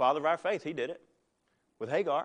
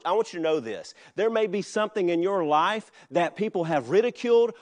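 louder, driving preacher's call; full-force preaching; 6 dB louder than usual. Acted. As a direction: press the point home to the congregation.

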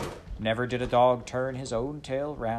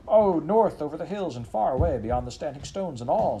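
higher, louder breathless dog voice; an eager dog blurting directions; dog's excited answer; 6 semitones higher, 6 dB louder than usual. Acted. Dog to farmer, eager and breathless.

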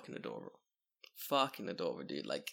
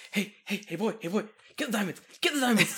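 high, loud coaxing a dog; coaxing burglar; 7 semitones higher, 4 dB louder than usual. Pretend. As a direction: call to the dog like a thief. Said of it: "Just, like, crouching down".

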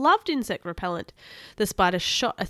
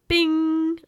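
high, loud ringing ping; bright-idea "bing"; 9 semitones higher, 7 dB louder than usual. A narrator's reading. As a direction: sing out a held high chime. Sound of sudden idea.

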